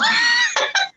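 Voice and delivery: very high squealing laugh; shrieking laugh. Real, not acted. Shrieking laugh after joke.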